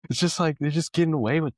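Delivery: high-pitched, tickled disbelief; amused, laughing exasperation. Real, not acted. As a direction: protest while recovering from a laugh. Still laughing at the anecdote.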